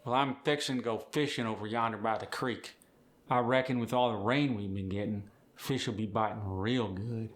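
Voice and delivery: easygoing Appalachian with dropped G's; Appalachian accent. Acted. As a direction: ramble like an Appalachian porch storyteller. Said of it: "dropping the G".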